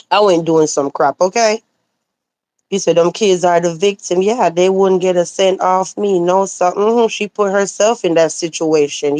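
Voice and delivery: usual speaking voice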